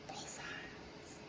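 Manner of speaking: hushed whisper, slow. Real, not acted. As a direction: whisper it to yourself while shuffling. Whispered aside while shuffling.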